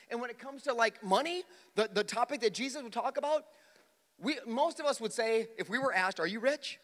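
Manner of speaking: high, loud pulpit preaching; full-force preaching. Acted. Pastor preaching hard to his congregation, loud and high. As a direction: preach it out to the room.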